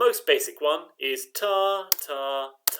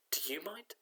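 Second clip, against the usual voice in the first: playful whisper; 16 dB quieter than usual. Acted. Teacher's playful aside to class.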